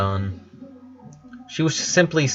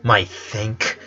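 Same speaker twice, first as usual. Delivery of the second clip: disappointed; slower than usual. Acted. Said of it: "sounding not upset, but disappointed".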